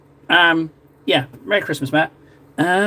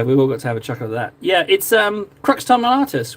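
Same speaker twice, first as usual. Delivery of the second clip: usual speaking voice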